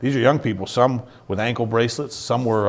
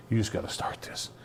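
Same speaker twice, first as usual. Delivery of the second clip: breathy, hushed urging; soft whispered repeat; 9 dB quieter than usual. Retold or imitated. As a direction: whisper it the second time. Wife repeats, voiceless and softer.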